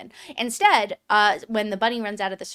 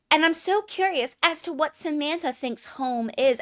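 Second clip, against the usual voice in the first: higher, falsely innocent bunny voice; pitched-up pointed politeness; mock sweet curiosity; 7 semitones higher than usual. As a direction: imitate a mean girl playing nice. Bunnies feigning curiosity to hurt.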